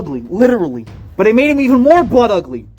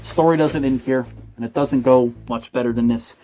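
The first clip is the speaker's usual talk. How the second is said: low, soft, hammed-up grave tone; mock somber narration; 7 semitones lower, 5 dB quieter than usual. Comedian addressing listeners about the sad ending, mock somber. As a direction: drop low like a tragic documentary.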